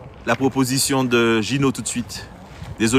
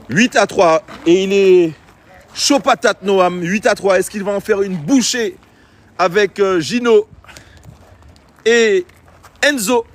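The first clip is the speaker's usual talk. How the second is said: pitched way up and louder, building hype; hyped score call; 6 semitones higher, 3 dB louder, slower than usual. Real, not acted. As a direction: sell the score like a live commentator firing up the crowd. Live score call, hyped commentary.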